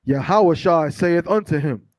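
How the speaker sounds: slow, measured scripture reading. Acted. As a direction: read the verse out slowly, weighting each phrase. Reads scripture aloud to congregation.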